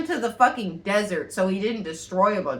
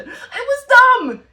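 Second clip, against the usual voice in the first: slow, much higher and louder, laughing; laughing shout; 13 semitones higher, 9 dB louder, much slower than usual. Real, not acted. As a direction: drag it out and yell it through laughter. Laughing verdict on the movie.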